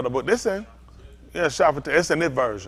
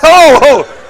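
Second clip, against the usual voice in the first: high, much louder hearty ho-ho laugh; jolly ho ho; 9 semitones higher, 17 dB louder than usual. Pretend. Imagined God chuckling at Tony, jolly ho-ho laugh. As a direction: boom out a big jolly ho ho.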